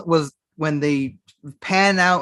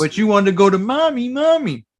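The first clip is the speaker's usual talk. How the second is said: mocking whine; 6 semitones higher, 4 dB louder than usual. Teasing co-host about the mommy plot.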